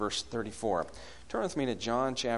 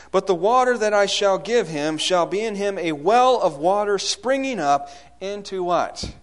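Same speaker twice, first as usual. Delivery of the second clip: higher, louder sermon delivery; impassioned preaching; 7 semitones higher, 8 dB louder than usual. Real, not acted. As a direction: preach it out with rising force.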